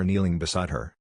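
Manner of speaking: plain reading voice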